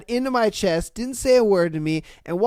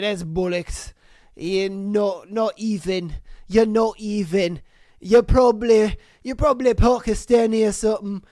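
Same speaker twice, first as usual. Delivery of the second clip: put-on dismissive Scottish voice; mock Scottish accent. Scotsman insisting the man is Pakistani, mock Scottish accent. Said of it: "Happened in Scotland".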